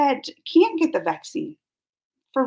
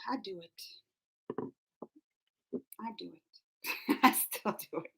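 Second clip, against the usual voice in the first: much softer, resigned; a soft sigh of surrender; sheepish quiet admission; 7 semitones lower, 17 dB quieter than usual. Real, not acted. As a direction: drop to a mumble, giving in. Sheepish admission after rant.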